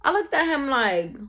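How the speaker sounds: plain reading voice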